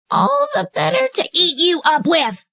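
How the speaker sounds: high put-on old lady voice; the wolf sweetly pretending to be grandmother; wolf in disguise imitating grandmother; wolf faking granny.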